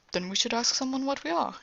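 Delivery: really excited. Acted. Afanasi suggesting they ask someone what they are, excited. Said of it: "he's really excited".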